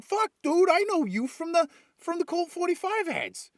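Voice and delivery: high, excited fanboy gush; thrilled to meet the guy from the ads; starstruck fan. Acted. Starstruck crew greeting the gun salesman, high and excited. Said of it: "it's like".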